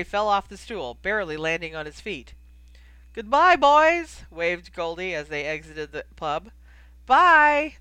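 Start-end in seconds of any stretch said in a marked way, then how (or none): 3.1-4.2 loud, high, drawn-out farewell; singsong shouted send-off; calling out. Acted. Innkeeper calls farewell across pub. 7.1-7.8 loud, high, drawn-out goodbye; big hollered farewell; calling back. Acted. They call back while leaving.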